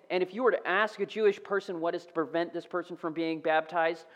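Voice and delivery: normal talking voice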